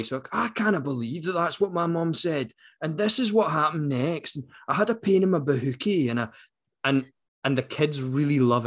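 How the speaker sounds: put-on young Glasgow boy narrating; Glasgow accent. Shown as a demonstration. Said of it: "read it in a sort of toned down Billy Connolly".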